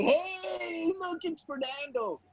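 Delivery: high, drawn-out hail from afar; stretched high-pitched mock shout; mock cheering greeting. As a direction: holler a teasing welcome, held back in volume. Cousins hail him as car arrives.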